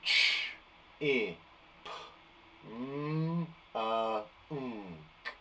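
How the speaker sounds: Ch, i, p, m, unk quietly sounded one by one; segmenting sounds. Shown as a demonstration. Teacher sounding out chipmunk for the class. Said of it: "I'm gonna do that one again".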